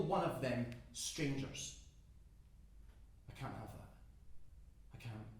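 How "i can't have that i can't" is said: low, very soft, to oneself; barely voiced refusal to accept death; hushed dread. Alone, facing death in the fire.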